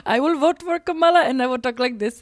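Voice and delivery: put-on Indian accent, measured and emphatic. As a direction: switch into a mock Indian accent. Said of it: "you can change your accent every week".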